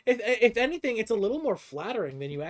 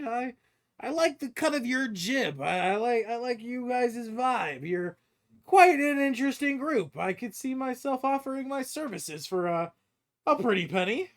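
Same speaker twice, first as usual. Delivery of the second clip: high-pitched, chummy sales pitch; amused dwarven hireling; 9 semitones higher, 3 dB louder than usual. Acted. Dwarven stranger offers the party his services, amused and chummy. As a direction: pitch yourself as a hired hand in a lifted character voice.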